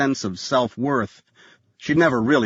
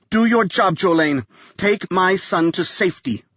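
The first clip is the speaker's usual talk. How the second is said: stern order; 7 semitones higher than usual. A narrator's reading. Mother ordering the nanny to save her son, stern command. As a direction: order her to act now.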